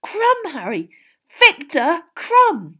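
high, loud and awestruck; thrilled fan exclaiming to his friend; starstruck excitement. A narrator's reading. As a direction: draw the famous name out in amazement. Stunned Ron raving about star.

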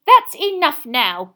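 slow, loud, high reprimand; exasperated motherly warning; scolding mother. A narrator's reading. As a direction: firmly call the boys to order. Mother scolds the joking twins.

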